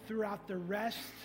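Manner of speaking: loud, lifted closing exhortation; preaching. Acted. Preacher urging the church to pray, loud and lifted. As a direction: urge them to pray it for themselves.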